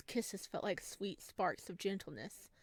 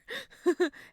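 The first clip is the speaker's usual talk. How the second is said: loud high-pitched giggle; 10 semitones higher, 9 dB louder than usual. Acted. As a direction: giggle loudly, embarrassed. Giggle while voicing scene.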